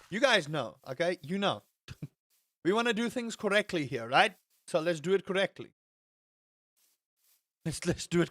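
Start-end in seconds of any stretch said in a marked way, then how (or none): none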